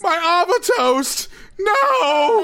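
high, hammed-up crying wail; mock despair over dropped toast, high and crying. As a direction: wail it like a fake tragedy.

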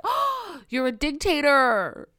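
gasp, then high, loud accusation; hammed-up scandalized outburst; mock horror. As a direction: gasp in mock shock and draw it out. Acts out horrified self-accusation.

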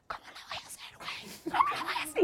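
voiceless rasp breaking into a high squeak; mock a friend with no voice left; imitated lost voice. Friend imitating Ashley unable to record, voice gone. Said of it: "se le había ido la voz".

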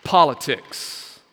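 Politics said slow after a long drumroll pause; big reveal. Shown as a demonstration. Preacher unveiling Politics to the congregation after a drumroll, slow and weighty. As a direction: hold back, then land Politics slowly. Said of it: "I want you to do a drum roll".

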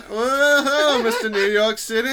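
high, loud mocking New York swagger; mock New York greeting. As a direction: tease him like a swaggering New York guy. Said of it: "this is the conflict of accents".

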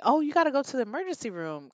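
imitating a high, quick brush-off; offhand hospital worker. Worker coming off shift redirecting a patient, imitated brush-off. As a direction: wave her away in a lifted, hurried voice. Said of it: "he tells me".

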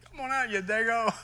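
high, mocking call-out; taunting neighbour. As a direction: jeer him out to fight. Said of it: "He wanted to fight me".

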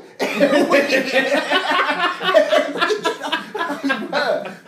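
big high burst of laughter; loud laugh. Real, not acted. Laughing at the Buckeye guy.

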